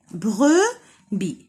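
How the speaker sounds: brebis split into "bre", pause, "bi"; syllable by syllable. Shown as a demonstration. Teacher counting syllables for children, brebis broken in two. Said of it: "Donc عندنا deux syllabes".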